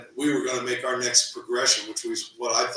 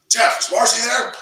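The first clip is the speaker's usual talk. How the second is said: higher and louder mock-serious ask; a teasing impression of the serious coach, higher and louder; all serious; 10 semitones higher, 7 dB louder than usual. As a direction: tease his all-business manner, pitched up high. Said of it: "the way he is, all serious".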